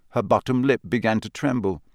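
ordinary delivery, nothing marked

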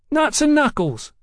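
put-on very high-pitched boyish sneer. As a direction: throw it back as a cheeky street-boy taunt. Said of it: "sneered the boy in wheeled shoes".

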